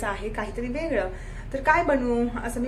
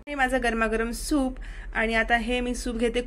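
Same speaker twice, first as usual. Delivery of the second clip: ordinary delivery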